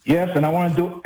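normal talking voice

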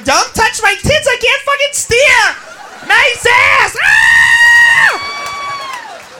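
put-on rowdy party girl. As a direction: yell over a packed car, ending in a squeal. Rowdy women in the car.

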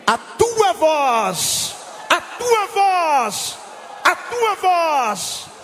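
high, very slow, loud pleading call; preacher crying out to God, long drawn-out vowels; fervent cry. Real, not acted. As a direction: stretch each phrase into a falling cry. Leading the congregation in fervent praise.

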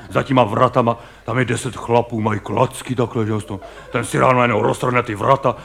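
usual speaking voice

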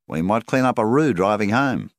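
banker Rawlings. A narrator's reading. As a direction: address a customer as the banker, pitched well up. Banker Rawlings talking to his customer.

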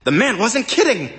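high-pitched exclamation; yelled amazement. A narrator's reading. Woman spotting a rocket from the window, astonished yell. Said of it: "She yelled".

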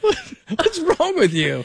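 high, slow, laughing teasing question; amused, giggling jab; laughing tease. Real, not acted. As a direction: poke fun at him mid-laugh. Teasing co-host while laughing.

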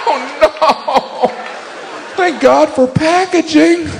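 high, slow thankful sigh of relief; mock relief. Comic voices relief about packaging, high-pitched and slow. Relieved after dog food bit.

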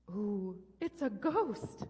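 put-on slow, drawn-out spooky ooh for the kids; play-scared, stretching the words out slowly; mock fear. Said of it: "James cried out in fear".

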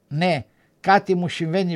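plain reading voice

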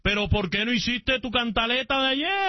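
high-pitched, protesting demand; heated banter; indignant challenge. Real, not acted. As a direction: climb in pitch as you confront your co-host. Heated mock quarrel with co-host.

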